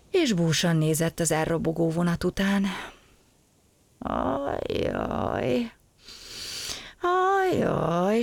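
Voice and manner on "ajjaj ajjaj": higher, drawn-out sighs; sighing lament. Acted. Gombóc Artúr sighing after the train leaves. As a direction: moan it with a long sigh. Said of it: "sóhajtozott".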